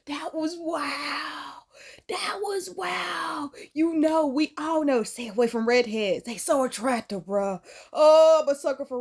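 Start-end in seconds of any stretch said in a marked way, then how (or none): 0.1-3.5 slow, soft, drawn-out disbelief; stunned wow. Real, not acted. Vlogger reacting to the story, stunned and slow. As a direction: let the wow hang in disbelief.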